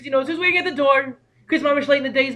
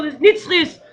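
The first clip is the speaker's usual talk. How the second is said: usual speaking voice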